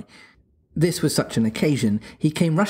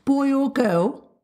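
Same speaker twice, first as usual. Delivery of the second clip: put-on small boy's voice, high and slow, unsure; not too hopefully; 13 semitones higher, slower than usual. Little Michael asks his mother, slow and hesitant. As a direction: ask it hoping but not expecting much. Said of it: "not too hopefully".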